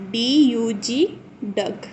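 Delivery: ordinary talk, nothing marked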